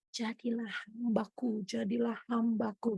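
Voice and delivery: soft, slow, nearly inaudible call; faint gentle voice. Shown as a demonstration. Preacher showing God's true voice, soft and slow. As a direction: barely breathe it out, slow and gentle. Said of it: "tapi halus tipis, nyaris tak terdengar".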